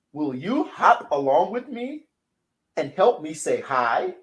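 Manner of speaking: put-on storybook voice for kids; reading to children. A narrator's reading. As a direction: read it bright for little ones.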